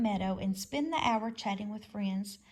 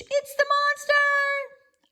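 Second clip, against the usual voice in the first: very high, loud, slow screech; 7 dB louder, much slower than usual. A narrator's reading. Owl screeches at the robot, very high and loud. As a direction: shriek the alarm at the monster. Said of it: "Swooper fluttered up from his branch and screeched".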